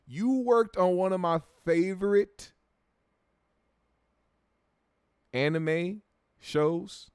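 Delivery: high, loud and drawn-out disbelief; amazed fan. Real, not acted. Amazed at viewer's credit.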